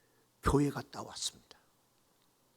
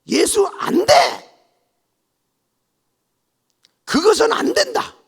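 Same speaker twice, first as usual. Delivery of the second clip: much higher, louder shout; shouted refusal; 13 dB louder than usual. Retold or imitated. Mother-in-law shouting no at the bride, loudly. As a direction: refuse her flatly.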